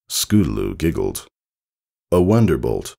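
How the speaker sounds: ordinary delivery, nothing marked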